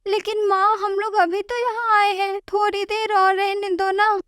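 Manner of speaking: a small daughter whining to stay a bit longer; pleading little girl. Acted. Little daughter pleading with her mother to stay, childlike whine.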